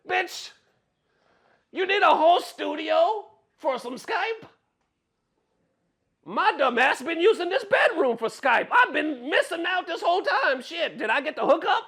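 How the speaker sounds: very high, loud, incredulous comic rant; mock outrage. Streamer ridiculing the scammer's studio excuse, shrieky mock outrage. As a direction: squeal it in laughing disbelief.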